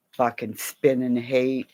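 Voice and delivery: ordinary talk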